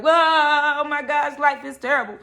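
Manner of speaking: hammed-up despair on a long whoa; mock wailing. Reader exaggerating a client's tears, mock wailing. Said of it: "I'm not seeing tears, tears like".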